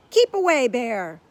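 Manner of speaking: hammy stage-actor warning, loud; dramatic. A narrator's reading. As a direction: ham up the theatrical fright. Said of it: "he said dramatically".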